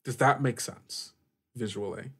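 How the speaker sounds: ordinary talk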